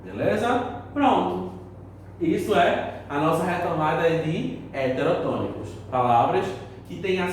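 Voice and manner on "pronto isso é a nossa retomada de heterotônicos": ordinary talk, nothing marked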